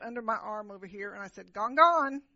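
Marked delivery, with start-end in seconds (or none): 1.5-2.2 put-on firm, louder toddler "gone, gone"; toddler's claim. Child telling dad the popcorn is gone, loud and firm. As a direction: clutch the bag and claim it loud.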